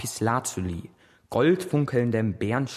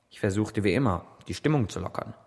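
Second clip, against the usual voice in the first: ordinary delivery, nothing marked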